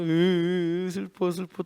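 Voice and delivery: hammed-up moaning over nothing; mock whimpering.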